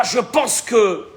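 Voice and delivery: ordinary delivery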